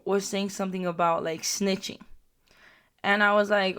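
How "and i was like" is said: usual speaking voice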